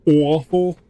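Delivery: awful, long O into A, rising at the end; awful with a rise. Shown as a demonstration. Streamer repeating awful the accented way. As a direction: say awful bent and rising, like a question. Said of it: "that's the way you pronounce that word".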